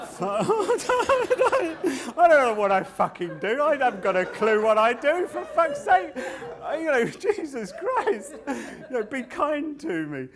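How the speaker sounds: high, loud comic wail; mock crying. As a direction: wail it out as if breaking down in tears. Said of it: "I normally cry at that point".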